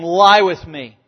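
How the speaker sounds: loud, forceful demand; a seductress's loud insistent command; emphatic command. Retold or imitated. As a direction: bark it as an order. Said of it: "and this is in an emphatic tone".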